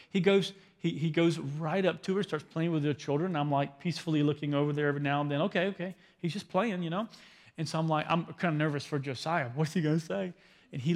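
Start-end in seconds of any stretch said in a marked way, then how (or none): none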